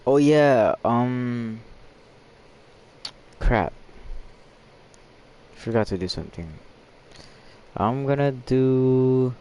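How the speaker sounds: ordinary talk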